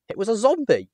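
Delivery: high, loud shriek of horror; terrified cry. Acted. Narrator shrieking on seeing the corpse sit up, terrified. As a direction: blurt it out in sudden terror.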